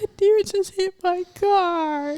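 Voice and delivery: high, slow tearful wail; retold crying. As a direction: wail the bad news, hammed-up. Retold tearful call to mom.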